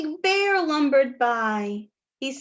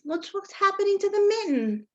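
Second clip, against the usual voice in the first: excited; 7 semitones higher, much faster than usual. Acted. Excited storytime aside to children.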